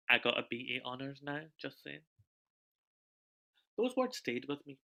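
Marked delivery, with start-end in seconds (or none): none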